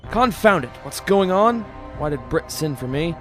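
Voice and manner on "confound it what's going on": loud, high irritated outburst; indignant and impatient; exasperated demand. Acted. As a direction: demand answers, fed up. Father demands answers at station.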